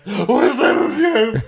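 drawn-out sarcastic yell. Retold or imitated. Brandy repeating the dress jab, slow drawn-out sneer. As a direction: drag it out mockingly. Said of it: "She goes".

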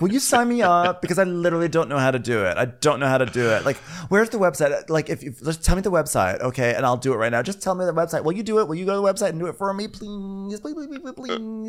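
high, pleading, fading to a squeak; high whiny begging trailing off; mock begging whine. Imitating Casey begging.

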